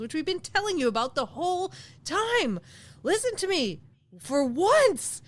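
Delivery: exasperated plea, pitch swooping high; mock exasperation. Mock exasperated rant at listeners.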